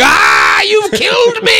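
high, loud, hammed-up dying wail; horrified dad imitation, shrieked at the top of the voice; mock agonized scream. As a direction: scream it like a melodramatic death. Acting out father's horrified reaction.

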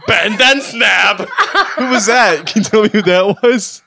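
slow, high Danny voice; Danny impression. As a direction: play Danny, drawn out. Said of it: "All of these were [name]".

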